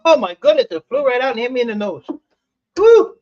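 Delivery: startled and thrilled; startled yelp. Real, not acted. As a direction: yelp as it hits your nose. Startled by flying screw.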